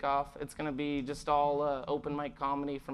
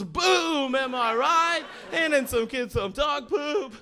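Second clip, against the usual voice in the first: high, loud, shouted boom; comic hyping up his finale; big closer; 9 semitones higher, 9 dB louder, slower than usual. Acted. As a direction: punch the ending to the crowd.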